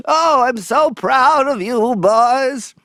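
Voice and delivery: put-on high, slow doting old-lady voice; old Hollywood dame beaming with pride; Katharine Hepburn. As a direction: gush like an aged grand dame. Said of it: "like Katharine Hepburn on On Golden Pond".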